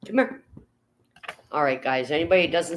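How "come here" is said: high coaxing call to a pet; high singsong come here for a cat. Real, not acted. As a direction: coax the cat up to the camera. Calling the cat up.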